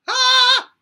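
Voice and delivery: very high, loud head voice ah. Shown as a demonstration. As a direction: ring it up into head voice. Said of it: "to the head voice".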